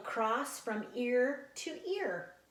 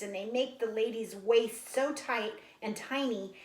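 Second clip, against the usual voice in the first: plain reading voice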